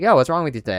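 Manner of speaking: usual speaking voice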